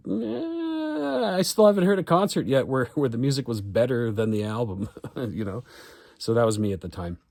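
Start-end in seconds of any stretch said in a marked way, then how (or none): 0.1-4.9 higher, mock dismissive voice; lightly voiced snobby teen; dismissive young self. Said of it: "And I was like".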